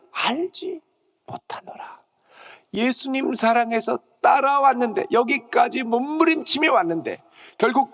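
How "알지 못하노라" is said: denial fading to whisper. Acted. 베드로의 부인 인용.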